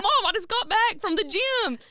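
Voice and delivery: lightly voiced defensive daughter; high mock whine. Daughter replaying her own defensive reply to mom. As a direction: whine it like a caught teen. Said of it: "And I'm like".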